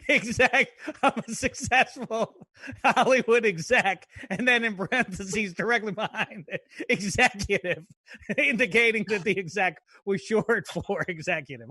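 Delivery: amused, laughing as they quote it back; real laughter breaking into the words. Real, not acted. Co-host teasing the writer about "exec", laughing.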